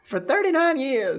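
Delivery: high squeaky penguin puppet; baby penguin voice. Pretend. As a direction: answer as the little penguin. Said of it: "baby penguin".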